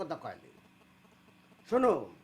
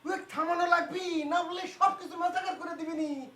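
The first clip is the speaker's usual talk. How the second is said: high-pitched, urgent outburst; alarmed warning; 7 semitones higher than usual. Acted. Warns family he will ruin everything, alarmed.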